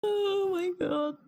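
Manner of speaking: high squeaky laugh-cry. Real, not acted. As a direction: gasp it out while cracking up. Cracking up at the voice.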